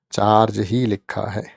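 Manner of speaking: normal talking voice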